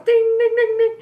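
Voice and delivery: high, loud ringing ding noises; imitated clang of metal. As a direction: ring it out like steel bar hitting the road. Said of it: "a piece hits the ground and goes".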